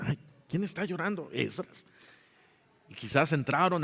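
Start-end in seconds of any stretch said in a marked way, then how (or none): 0.0-1.8 murmured question, soft and quick; hushed passerby. Acted. A passerby at Esdras's house hears weeping, hushed.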